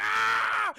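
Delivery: high, loud sudden yell; hammed-up wild shriek; mock scream. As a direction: scream as the old man lunging out. Acting old man popping up.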